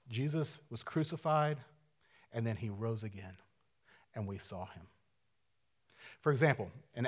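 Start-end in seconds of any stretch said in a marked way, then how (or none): 0.1-4.9 starts soft and sinks almost to a murmur; fading to a hush. Acted. To the congregation, the message fading to a hush. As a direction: let it fall away to nearly nothing.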